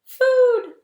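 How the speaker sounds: high, loud squeaky hatchling call; tiny gosling cry. Acted. Newborn gosling calling the robot for mama. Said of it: "was the only response".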